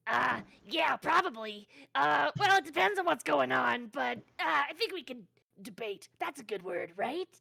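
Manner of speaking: high, loud goblin voice. Shown as a demonstration. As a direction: squeak it out like a goblin. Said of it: "do I have a goblin voice? Let's see".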